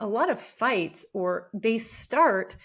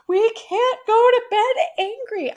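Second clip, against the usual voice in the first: sobbing, high and loud, slowly pleading; loud crying; 9 dB louder than usual. Retold or imitated. Wife sobbing at her husband as he falls asleep, high loud wail. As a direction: wail it like a desperate crying plea. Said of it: "I'm, like, crying loudly".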